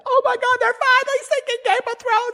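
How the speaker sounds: very high, squealing mock fan scream; imitated scream. Imitating a fan thrilled by Game of Thrones, mock screaming. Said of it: "somebody who just screamed and said".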